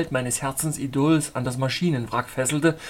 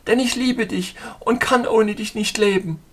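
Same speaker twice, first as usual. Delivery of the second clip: a higher woman's voice, fervent; heroine's vow; 7 semitones higher, 4 dB louder than usual. Acted.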